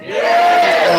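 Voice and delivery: compère rousing the audience; crowd-hyping shout. Real, not acted. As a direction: whip the room up into a roar.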